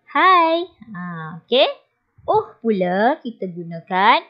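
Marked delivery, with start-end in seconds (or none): 0.1-0.6 higher, louder hai; calling hai. Shown as a demonstration. Teacher demonstrating hai to get someone's attention. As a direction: call out to catch attention. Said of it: "menarik perhatian atau menegur seseorang".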